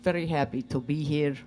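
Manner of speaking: lightly put-on Russian accent. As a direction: speak as Svetlana with a Russian accent. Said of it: "Tonight, Svetlana".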